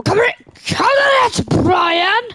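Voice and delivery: high panicked shout; panicked yelling. Real, not acted. Gamer trapped in a maze calling for Brian. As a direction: yell out in real panic.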